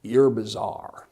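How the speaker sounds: deadpan put-down from a tough worker; lightly voiced construction worker, slow and low; gruff worker. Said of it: "he's chewing snuff and he looks, he goes".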